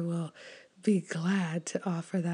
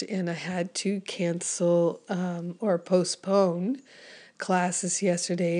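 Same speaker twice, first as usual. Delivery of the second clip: ordinary talk, nothing marked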